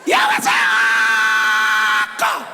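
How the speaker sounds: hammed-up pastor's booming prayer; old-time pastor. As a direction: roar out a stretched cry to God. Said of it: "the pastor they stand like this".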